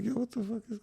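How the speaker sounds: soft, very high-pitched retold disbelief; high baffled reaction. Husband retelling his shock at the stranger greeting his wife, high baffled voice.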